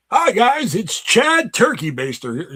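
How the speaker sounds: high, loud, slow character greeting; mock intro voice for the Chad character; Chad Turkey Baster voice. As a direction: announce yourself as a hammy vlog host. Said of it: "my Chad Turkey Baster".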